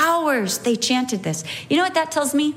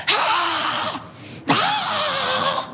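put-on high yelling ah, a child's tantrum; mock toddler scream; 13 semitones higher than usual. Mother imitating her toddler's tantrum in the fit chair. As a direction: play the screaming two-year-old. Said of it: "she was just like".

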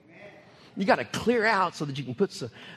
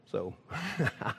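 laughing; 6 semitones lower, 6 dB quieter than usual. Real, not acted. Laughing at lost-job thought.

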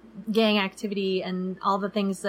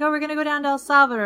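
higher, louder and fast, breezy; quick breezy reply; 7 semitones higher, 4 dB louder, much faster than usual. Retold or imitated. Couple answering the cab driver, quick and high. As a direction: rattle it off brightly. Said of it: "We're like".

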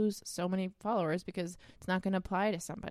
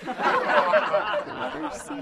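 laughing through the words; 5 dB louder, much slower than usual. Real, not acted. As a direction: giggle out the punchline. Laughing at own joke.

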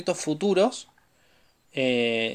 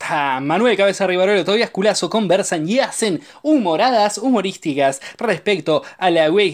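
fast announcer read; podcast intro; 4 semitones higher, 4 dB louder than usual. Acted. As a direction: announce the show brightly. (presentación de podcast)

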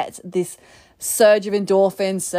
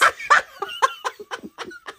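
high-pitched laugh. Real, not acted. Laughing at partner's interruption.

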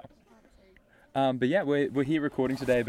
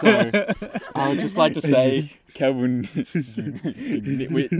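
laughing; 3 semitones higher, 7 dB louder, much slower than usual. Real, not acted. Teasing friend, laughing.

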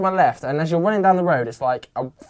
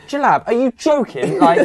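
laughing disbelief; 8 semitones higher, 6 dB louder than usual. Retold or imitated. Mocking friend who is flagging.

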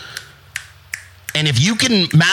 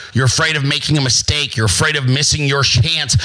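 ordinary talk, nothing marked

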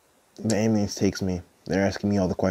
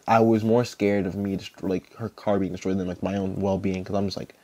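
ordinary delivery

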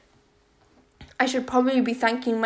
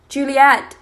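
loud, urgent yell of a name; alarmed father's yell; 3 semitones higher, 9 dB louder than usual. A narrator's reading. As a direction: shout to reach someone down the phone. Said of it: "he is yelling".

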